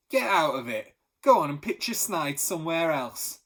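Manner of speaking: loud market bellow; costermonger shout. Acted. Costermonger shouting at a customer with fake coins, loud. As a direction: drive the cheat off with a bellow. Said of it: "shout at a baffled customer".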